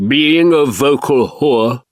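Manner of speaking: put-on rich tremulous old actor voice; quavering grand theatrical delivery; Sir Michael Hordern impression. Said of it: "my impression of The Voice".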